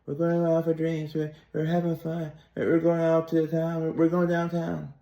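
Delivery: upbeat neurotypical friend voice. Retold or imitated. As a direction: imitate the eager friend going out for drinks. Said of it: "I switched my accent for neurotypicals".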